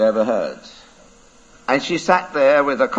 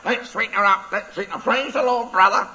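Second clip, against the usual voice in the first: high squawky parrot chant; 7 semitones higher than usual. Acted. Parrot voiced in story.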